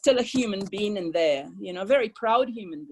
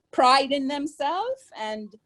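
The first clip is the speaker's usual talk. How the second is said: ordinary talk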